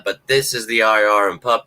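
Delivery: usual speaking voice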